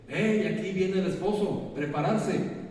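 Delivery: high, loud heralding cry; calling out. Acted. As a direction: call out to announce an arrival. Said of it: "la voz cuando dice". (pregón en voz alta y aguda)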